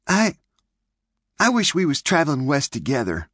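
put-on high girl voice, bold confession; boldly. May boldly telling Clint her wish. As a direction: blurt it out bravely. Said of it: "said May boldly".

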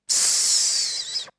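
soft unvoiced hiss; hissing tss. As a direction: let out a short hiss. Noise after pings. (leises Zischen)

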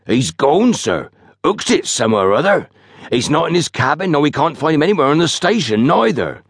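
high-pitched rustic station master; agitated station master. A narrator's reading. Station master tells Fenn the motorman vanished, agitated. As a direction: report it in a high, worried country voice.

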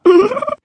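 very high, loud tickled giggle; tiny giggle. Acted. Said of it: "das ihm lachend zurief".

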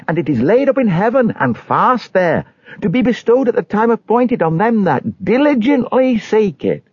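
high-pitched, rapturous testimony; high, zealous pilgrim voice. Acted. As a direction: proclaim your hope in a high, eager voice.

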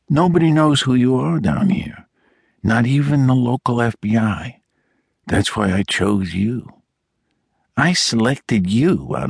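put-on Brooklyn accent. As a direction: slide gradually into a hint of Brooklyn. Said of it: "His voice had changed as he spoke. It was more nasal now".

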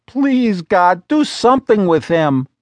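exasperated plea to God. A narrator's reading. Wife appealing to God about her husband, exasperated. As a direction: throw it up to heaven, fed up. Arms raised, pleading to heaven.

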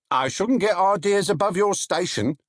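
a hint of a wry market trader; warning trader. A narrator's reading. Narrator voices trader.

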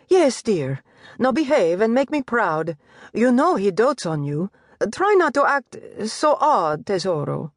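high, lilting mother announcing news; Italian mother's voice. Acted. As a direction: voice the doting Italian mistress. Mother coaxing her son.

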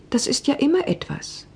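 ordinary delivery, nothing marked

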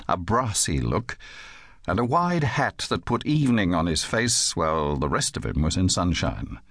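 plain reading voice

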